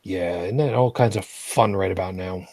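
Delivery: low, dry sarcasm; deadpan it, pitched well down; sarcastic. Real, not acted. Host answers grim news from a co-host, sarcastic. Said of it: "that was sarcasm".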